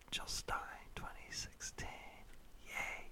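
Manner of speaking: breathy whispered plug; whispered aside. Acted. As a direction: whisper it like a secret. Joke campaign plug whispered.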